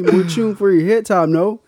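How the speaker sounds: put-on loud, overdone Jamaican accent; bad Jamaican accent. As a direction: butcher a Jamaican accent on purpose. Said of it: "straight Canadian trying to do a Jamaican accent".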